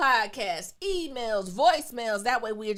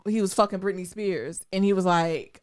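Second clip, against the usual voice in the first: normal talking voice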